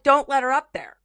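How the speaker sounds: a worker shouting to keep her out; workers' warning. Retold or imitated. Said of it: "they're like".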